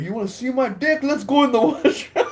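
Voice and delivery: high-pitched mock challenge breaking into laughter; high, heated dare ending in a laugh; retold snapping. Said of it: "I don't know why I was like".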